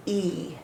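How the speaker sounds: "E" said as a soft, drawn long "ee"; long E sound. Shown as a demonstration. Teacher modelling the long vowel for students, soft and drawn. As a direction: say "E" softly as a long "ee". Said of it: "say its long E sound".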